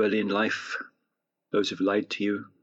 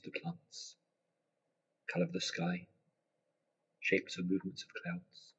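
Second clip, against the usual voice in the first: guided meditation; 4 dB quieter than usual. Acted. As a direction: gently guide them into relaxation.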